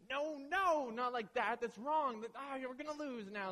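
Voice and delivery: high and loud panicked no no; husband panicking at his fiance's wrong card, shrill and loud; frantic correcting. Retold or imitated. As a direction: yelp it like the game is lost. Scolding partner's wrong move.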